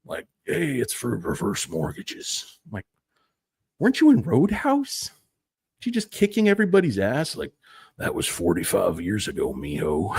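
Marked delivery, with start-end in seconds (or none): 0.5-2.6 put-on old star voice. Aging star in an advert. 3.8-7.3 high, disbelieving fan; incredulous. Pretend. Fan confronting aging star. 8.0-10.2 put-on old star voice. Aging star replies.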